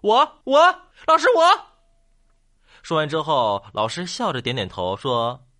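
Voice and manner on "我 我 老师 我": high, loud pupils clamouring to be picked; excited classroom shout, voice jumping up; eager shouting. Acted. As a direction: yell over each other for teacher's attention. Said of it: "争先恐后的喊道".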